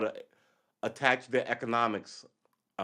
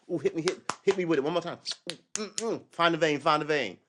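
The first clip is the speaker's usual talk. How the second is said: higher-pitched, hammed-up craving with moans; mock addict; 5 semitones higher than usual. Junkie begging pusher for Western goods, mock craving. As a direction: beg for a fix, play-acted. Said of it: "your pusher man is gone".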